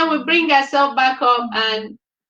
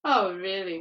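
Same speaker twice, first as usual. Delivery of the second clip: plain reading voice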